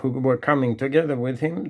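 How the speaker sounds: usual speaking voice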